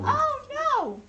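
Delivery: high playful wail; mock panic. Reader grabbing child in play, mock panic. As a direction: wail in pretend horror.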